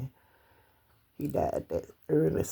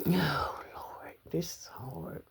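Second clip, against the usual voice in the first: tearful near-whisper, very soft; crying; 4 dB quieter, slower than usual. Real, not acted. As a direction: whisper it to God, fighting back real tears. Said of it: "Sitting up here crying".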